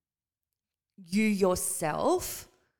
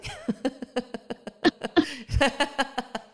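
a long real laugh that rises, then falls away; burst of laughter; 6 semitones higher, 5 dB louder than usual. Real, not acted. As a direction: laugh out loud at your own joke. Laughs at her own PMS joke.

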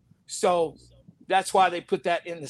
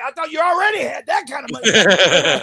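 higher, louder exclaim of amazement; mock astonished shout; 5 semitones higher, 7 dB louder than usual. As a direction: call out in amazement. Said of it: "it was like".

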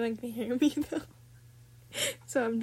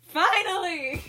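excited cheer; 7 semitones higher, slower than usual. Retold or imitated. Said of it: "And I'm like".

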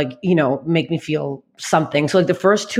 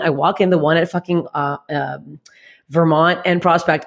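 ordinary talk

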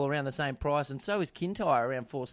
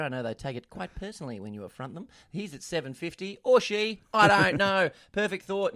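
usual speaking voice

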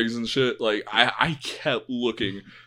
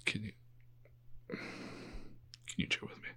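whisper; 3 semitones lower, 23 dB quieter, much faster than usual. Real, not acted. Told to be quiet.

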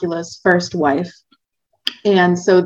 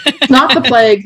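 laughing; 6 semitones higher, 6 dB louder than usual. Real, not acted. Laughing along at host's joke.

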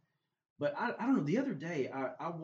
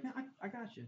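soft, higher-pitched casual brush-off; nonchalant; 11 semitones higher, 7 dB quieter than usual. Retold or imitated. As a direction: play it down lightly, no big deal. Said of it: "Just being very nonchalant".

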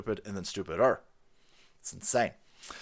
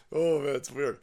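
usual speaking voice

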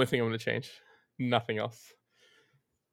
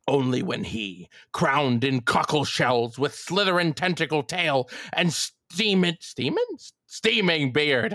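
Hark monologue; 2 semitones higher, slower than usual. Acted. Keeper damning young Winslow, slow theatrical roar. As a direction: declaim it like a raving old sea dog. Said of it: "the entire Hark speech".

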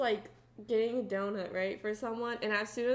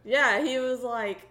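usual speaking voice

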